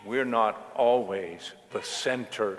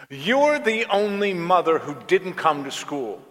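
higher and louder, building outrage; angry teen rant; 6 semitones higher, 6 dB louder than usual. Retold or imitated. Daughter ranting at her mother about other parents, angry and loud. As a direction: pile up every grievance in a furious rush.

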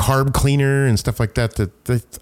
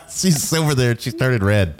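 laughing; 5 semitones higher than usual. Real, not acted. Laughing at the blushing co-host.

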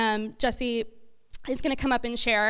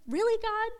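pitched higher, an incredulous retold cry; exasperated disbelief; 10 semitones higher, 4 dB quieter than usual. To God, about her dad's words, higher incredulous exasperation. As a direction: throw the question at God in disbelief. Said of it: "I was angry".

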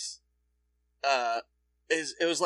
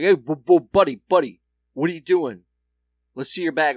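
louder, stammering call to halt; imitating a store guy calling after someone; acting out a suspicious clerk; 3 semitones higher, 7 dB louder than usual. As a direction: stop them with a loud, insistent challenge. Acted store guy stopping them.

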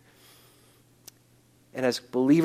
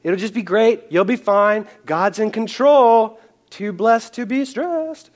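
higher, slow chirpy mock platitudes; mocking fixers; 8 semitones higher, slower than usual. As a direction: parody of well-meaning cheerleaders. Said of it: "they throw a lot of Bible verses at you".

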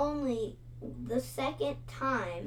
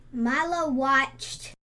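plain reading voice

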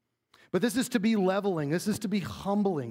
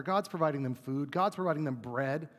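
usual speaking voice

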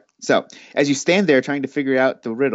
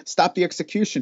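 a mildly raised, higher call; loud voice; 7 semitones higher than usual. A narrator's reading. Chancellor calling to halt the execution, mildly raised. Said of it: "he says in a loud voice".

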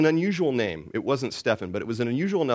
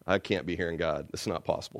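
quiet inner doubt; 7 semitones lower, 8 dB quieter than usual. Retold or imitated. Inner disbelief.

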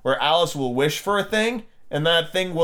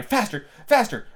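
high-pitched urging cry; Queen's mild, high cry; 7 semitones higher than usual. A narrator's reading. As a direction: urge a runner on in a high voice. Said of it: "the queen kept crying".

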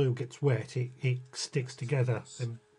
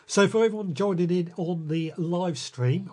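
ordinary talk, nothing marked

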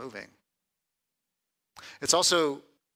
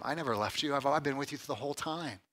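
soft, gentle assurance; hushed reassurance; 7 dB quieter than usual. Acted. Preacher voicing Jesus comforting confused disciples, hushed. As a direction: reassure them quietly.

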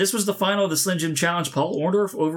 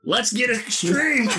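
slow, higher announcer hype; 7 semitones higher, much slower than usual. Pretend. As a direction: announce it like a wrestling promo. Mock announcer for segment.

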